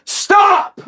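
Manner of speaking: high, loud theatrical stop after throat clear; hammed-up yell. Witness standing up to yell, hammed-up high shout. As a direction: throw it out like a big announcement. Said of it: "clears your throat".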